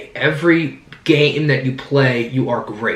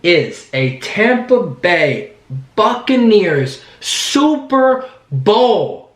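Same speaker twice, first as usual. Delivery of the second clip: slow, drawn-out yell rising higher; celebratory yell; 6 semitones higher, much slower than usual. Real, not acted. Host leading fans in a Super Bowl victory, celebratory yell. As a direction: announce it big, stretching every word. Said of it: "I'm gonna yell".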